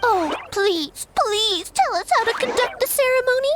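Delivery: high, fast, hammed-up begging; mock pleading humans. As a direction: mimic the silly humans begging for answers. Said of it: "I know exactly what they're going to ask".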